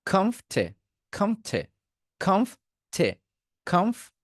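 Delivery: কমফ ঠে in two separate high-pitched syllables; broken into parts. Shown as a demonstration. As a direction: drill "khomf... the" with aspirated t on ঠে. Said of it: "আমি ভাগ ভাগ করে পড়াচ্ছি". (কমফ ঠে ভাগ ভাগ করে, উঁচু সুরে)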